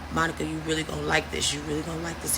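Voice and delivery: imitating a man's low, hushed coaxing; murmur in the ear. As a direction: drop low and very soft, as if right in someone's ear. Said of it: "He in my ear like".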